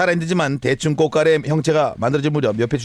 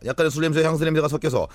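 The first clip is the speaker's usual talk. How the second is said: ordinary talk, nothing marked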